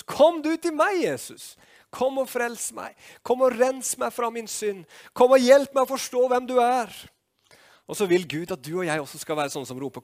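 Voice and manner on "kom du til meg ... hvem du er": high, pleading pulpit cry; calling out to Jesus. Acted. Believer calling on Jesus for salvation, high pleading cry. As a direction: raise the voice high in appeal, building louder. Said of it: "så må vi selv rope til Jesus".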